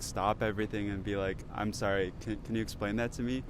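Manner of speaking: ordinary talk, nothing marked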